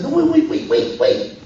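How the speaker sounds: high-pitched acted plea to wait; urgent pleading. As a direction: stop them before they walk off. Pleads with listeners about to leave.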